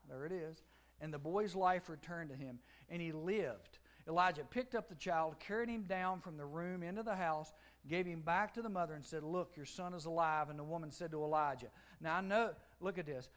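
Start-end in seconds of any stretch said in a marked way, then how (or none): 1.0-12.6 full-force pulpit reading; fervent preaching. Acted.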